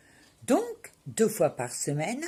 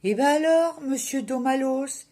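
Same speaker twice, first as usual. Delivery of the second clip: high, louder pep-talk opening; cheering someone up; 6 semitones higher, 4 dB louder than usual. Acted. Patient trying to lift the kiné's morale, encouraging. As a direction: lift his morale with a raised, encouraging call. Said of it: "J'essayais alors de lui remonter le moral".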